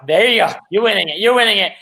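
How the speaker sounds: mildly hyped imitation of a cheering friend; excited cheering on. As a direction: egg a friend on, rapidly. Voicing friend egging her on.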